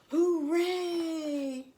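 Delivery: a high, distant echoed cheer; echo shout. A narrator's reading. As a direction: shout back like a faraway echo. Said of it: "the echo shouted back to them".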